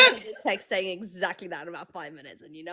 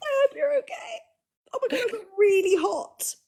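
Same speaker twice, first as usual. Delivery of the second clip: tearful; 13 semitones higher than usual. Real, not acted. Emotional reaction to coming out message.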